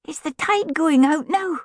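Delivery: put-on very high, eager child's voice; hopeful little boy. A narrator's reading. Boy asks parents about the harbour tide, high and hopeful. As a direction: ask hopefully as a small boy. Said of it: "he asked hopefully".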